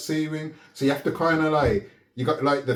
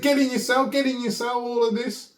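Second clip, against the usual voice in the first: higher, pushy mock orders from the officer; mock officer shouting; 8 semitones higher than usual. Prison officer ordering him into his cell, mock shouting higher. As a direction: bark it out like the guard, pitched up. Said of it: "he started shouting in my face".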